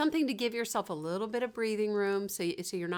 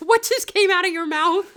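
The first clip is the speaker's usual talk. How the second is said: higher and much louder, half laughing; shocked at yourself; 10 semitones higher, 12 dB louder than usual. Retold or imitated. Retelling blurting something in an argument, shocked and laughing. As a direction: gasp at your own words, laughing. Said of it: "You're like".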